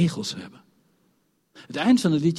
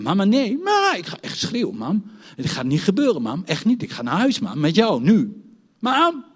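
put-on louder, high pleading toddler; pleading child; 5 semitones higher, 5 dB louder than usual. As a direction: beg mama not to leave. Child begs mother to stay.

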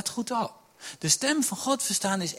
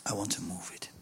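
hushed; 9 semitones lower, 10 dB quieter than usual. Acted. God's quiet version of phrase.

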